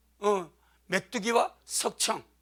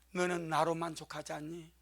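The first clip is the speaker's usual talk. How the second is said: much softer, gentle question; soft voice of the Lord; 5 semitones lower, 11 dB quieter than usual. Retold or imitated. The Lord speaking to the despairing pastor, soft. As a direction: ask it low and tender. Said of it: "주의 음성이 너무나 선명하게".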